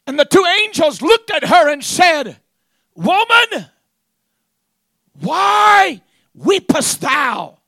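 loud, high, drawn-out pulpit shout; preaching at full force. Acted. Preacher retelling the angels questioning Mary, shouted preaching.

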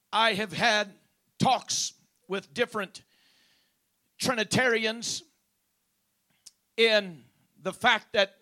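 loud, high, measured preaching with long pauses; forceful preaching. Real, not acted. Preacher introduces the argument with Trinitarians, forceful deliberate preaching. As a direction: project each phrase and let it hang.

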